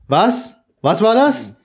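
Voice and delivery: high, loud mock puzzlement; mock confusion. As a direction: act like you can't hear. Mocks whispering co-host.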